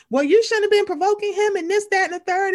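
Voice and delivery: hammed-up high imitation, brushing it off; mocking Portia. Imitating Portia at the reunion, high mocking voice. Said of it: "Portia was like".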